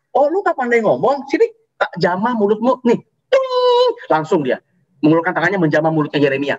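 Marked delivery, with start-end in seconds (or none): 0.1-3.0 breezy God voice. Acted. God offhandedly fixing speech problem. 3.3-3.9 a high, bright sung "ting" like a sparkle; ping it out like a magic chime. Acted. Preacher marking God's touch on Jeremiah's mouth with a chime sound.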